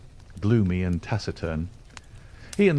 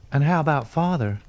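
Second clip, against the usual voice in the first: put-on higher young woman's voice; shy young girl; 7 semitones higher than usual. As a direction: ask it shyly, a young girl. Lucy asks about father.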